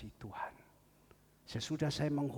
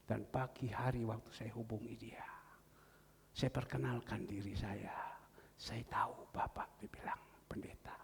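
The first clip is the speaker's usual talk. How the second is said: hushed retelling; 5 semitones lower, 5 dB quieter than usual. Acted. Retelling the phone call hushed.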